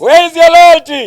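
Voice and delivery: high booming rally shout; booming ideological slogans. Real, not acted. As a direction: call out to the crowd at full force. Call and response slogans.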